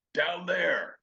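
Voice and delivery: loud, high, stretched-out comic holler; acted yell from someone far above; shouted punchline. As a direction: belt it out as the punchline. Acting out bit's punchline.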